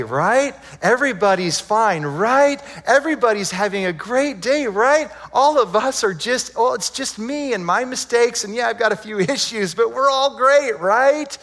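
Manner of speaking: mock reassurance. Preacher mimicking people who deny their faults, mock-cheerful. Mocking everyone's pretence of being fine.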